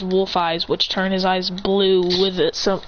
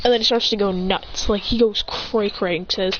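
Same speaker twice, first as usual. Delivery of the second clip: ordinary delivery, nothing marked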